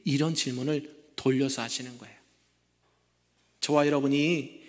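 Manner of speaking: usual speaking voice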